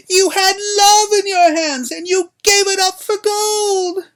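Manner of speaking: high, loud, slow crone yell; yelling old woman. A narrator's reading. Old woman yelling at Buttercup, high and loud. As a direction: scream the accusation like an ancient hag. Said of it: "suddenly she was yelling".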